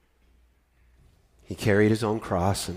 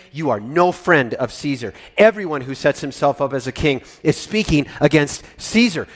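louder, higher threat against Pilate; mob shouting; 5 semitones higher, 10 dB louder than usual. Acted. Judeans threaten Pilate, loud angry shout. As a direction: shout them down with Caesar's name. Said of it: "the Judeans shouted at him".

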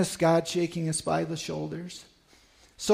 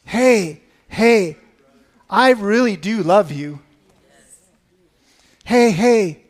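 mild yell; 7 dB louder than usual. Retold or imitated. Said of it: "and he is yelling".

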